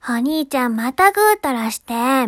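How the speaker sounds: high, loud scolding little-sister voice; little-sister character. Pretend. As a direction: play the nagging little sister, drawing it out. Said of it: "妹系キャラみたいなさ". (声高めの妹系キャラで叱る)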